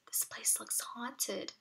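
whispered, slow and scared; hushed whisper. A narrator's reading. Little brother whispering that the place looks haunted. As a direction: breathe it out like a frightened boy. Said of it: "He lowered his voice".

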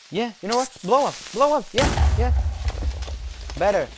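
high excited egging on; cheering. Real, not acted. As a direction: urge the creeper to explode. Cheering a creeper on.

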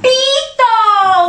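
syllables joined. Shown as a demonstration. Teacher revealing the word to children, syllables joined. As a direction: blend "Pito" together as a triumphant reveal. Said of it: "Junto, ¿qué dice?".

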